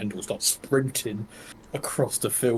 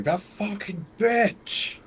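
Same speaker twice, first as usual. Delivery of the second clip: high, drawn-out scream of rage; enraged scream; 12 semitones higher, much slower than usual. Acted. Archer throws the bow down in a fit of rage, screaming. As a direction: scream it slowly, pitched right up. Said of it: "just scream".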